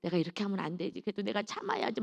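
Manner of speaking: soft, restrained self-talk; acted inner voice of self-restraint; reasoning with oneself. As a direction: hold it in, talking yourself down quietly. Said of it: "이성적으로".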